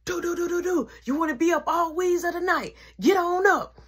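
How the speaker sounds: high sing-song mom wake-up call; mom waking him up. Retold or imitated. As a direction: sing him awake like a pushy mother.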